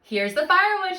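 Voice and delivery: loud, high call; excited shout. A narrator's reading. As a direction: shout it out triumphantly. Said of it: "shouted Jack".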